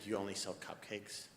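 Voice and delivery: talking low. Retold or imitated. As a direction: go quiet with disbelief. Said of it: "start talking so low".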